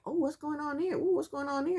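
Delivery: mock-concerned oohing; nosy couples. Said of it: "The other couples are like".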